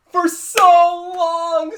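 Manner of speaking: loud, high, drawn-out cry; real emotional fan outburst; overjoyed wail. Real, not acted. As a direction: stretch it out slowly in high delight. Overjoyed at voice actor's return.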